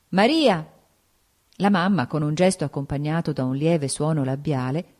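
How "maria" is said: raised, higher-pitched call; calling out loud. A narrator's reading. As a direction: call her name out across the room. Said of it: "ad alta voce chiamò".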